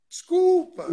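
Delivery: mock the girl's stubborn "Desculpa"; imitating the girl. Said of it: "Ela falava". (imitando a menina que insiste em "Desculpa")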